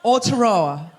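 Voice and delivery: Aotearoa said low and firm, falling; careful pronunciation attempt. Shown as a demonstration. Comedian trying the hard place name before the crowd, deliberate attempt. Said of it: "Everyone says it differently".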